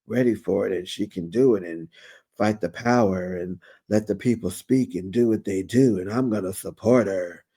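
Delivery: low deep mock husband voice; impression of Dr. Damon. Retelling Damon backing Heavenly, in a low voice. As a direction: drop the voice down for the man. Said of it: "Doctor Damon".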